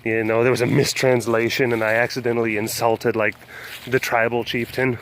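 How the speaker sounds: ordinary talk, nothing marked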